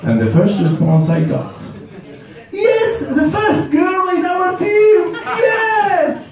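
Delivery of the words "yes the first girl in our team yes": much higher, shrill-free delighted cheer; mock overjoyed colleague shouting yes. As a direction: cheer like you finally got a girl on the team. Colleague cheering first girl.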